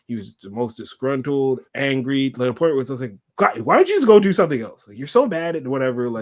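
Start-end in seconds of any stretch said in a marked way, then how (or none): 3.4-6.1 higher-pitched exasperated complaint; louder at the start, fed up. Retold or imitated. Passenger fed up with a grumpy bus driver, exasperated.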